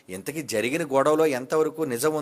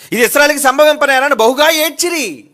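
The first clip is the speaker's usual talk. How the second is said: loud scripture reading; 11 semitones higher, 13 dB louder than usual. Acted.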